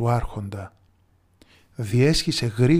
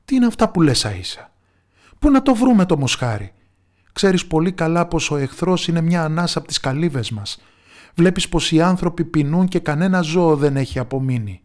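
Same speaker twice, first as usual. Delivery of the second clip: higher, louder, upset ruler; distressed lord, higher and louder; agitated; 4 semitones higher, 6 dB louder than usual. A narrator's reading. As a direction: protest in alarm at the request. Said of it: "είπε ανάστατος".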